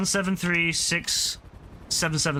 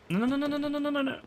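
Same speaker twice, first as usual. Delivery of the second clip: rapid high panicked no's, higher pitch; yelping in alarm at the game, higher; 10 semitones higher, 4 dB quieter, faster than usual. Real, not acted. Panics as game goes wrong.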